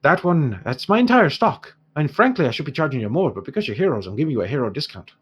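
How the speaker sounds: lightly Irish dwarf trader, lifted openings; haggling dwarf shopkeeper. Acted. Dwarf quotes heroes his whole stock, lightly voiced and protesting. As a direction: haggle as a put-upon dwarf.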